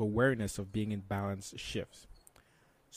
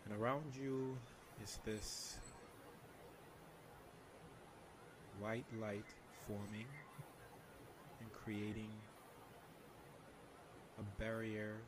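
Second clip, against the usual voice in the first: soft spa whisper; 16 dB quieter than usual. Shown as a demonstration. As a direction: guide them into calm, very softly. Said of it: "can you lead us through a meditation".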